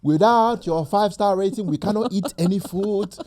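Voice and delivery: put-on light Nigerian accent pleading for ratings; hint of Nigerian accent, begging. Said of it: "do it in a Nigerian accent".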